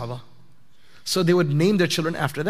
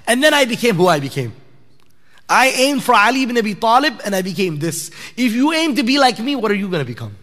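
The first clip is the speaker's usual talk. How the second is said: raised, higher-pitched voice of a father driving his point home; emphatic retelling; 5 semitones higher, 4 dB louder than usual. Retold or imitated. Father challenging his son to aim higher, emphatic. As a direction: retell it with rising force.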